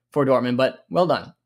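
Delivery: usual speaking voice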